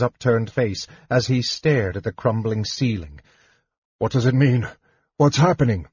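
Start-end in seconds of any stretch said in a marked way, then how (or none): 4.0-5.9 alarmed questions, rising in pitch. A narrator's reading. Warrior to his brother under a crumbling ceiling, alarmed. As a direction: ask in mounting alarm, voice lifting. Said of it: "he asked in alarm".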